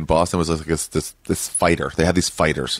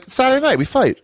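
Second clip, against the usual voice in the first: much higher, deadpan and proud; matter-of-fact brawler. Retold or imitated. A Boston brawler explaining weekend fights, much higher and deadpan. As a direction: state it like an obvious rule.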